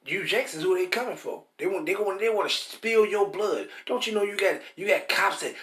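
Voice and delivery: ordinary talk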